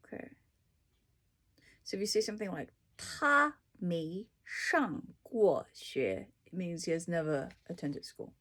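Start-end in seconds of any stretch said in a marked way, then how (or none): none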